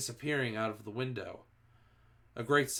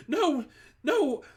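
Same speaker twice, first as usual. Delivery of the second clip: much higher, louder desperate no; terrified begging; 8 dB louder than usual. A narrator's reading. Front-row witch begging for her life, high loud desperate cry. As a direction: scream for mercy. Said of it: "begged the witch".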